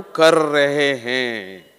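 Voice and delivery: very slow, drawn-out कर रहे हैं; emphatic insistence. Real, not acted. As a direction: stretch every word of कर रहे हैं to drive it home. Insists others are following him. (ज़ोर देकर, खींचकर कर रहे हैं)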